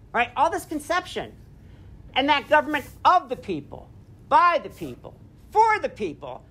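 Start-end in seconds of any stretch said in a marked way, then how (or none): 2.1-6.4 "of", "by", "for" each leaned on before "the people"; rhythmic triplet. Shown as a demonstration. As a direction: land each "people" in a rising series. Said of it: "because he's emphasizing people".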